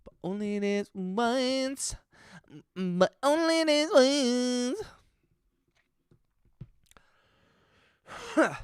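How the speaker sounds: slow, soft teasing repeat. Acted. Streamer teasing Jack in chat, slow hushed mock warning. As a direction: stretch each word in a hushed mock-stern tease.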